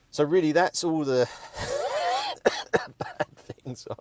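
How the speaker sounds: loud start breaking into a cough; choking on a cough mid-sentence; out of breath. Real, not acted. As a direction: run out of breath and cough through the words. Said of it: "I'm just out of breath".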